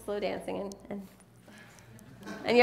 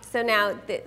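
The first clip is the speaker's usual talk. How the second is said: usual speaking voice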